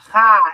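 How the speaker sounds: ha as a throaty mock choke; mock choking ha. Shown as a demonstration. Teacher mocking the Yiddish sound as choking. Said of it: "are you choking".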